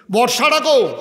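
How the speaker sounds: high, loud shouted question; calling out. Acted. Searching at home for the spear, hollered. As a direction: holler it through the house.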